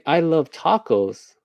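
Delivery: slow, high, drawn-out enthusiasm; mock gushing. Signer fed a line about tacos, mock gushing. Acted passerby gushing on screen.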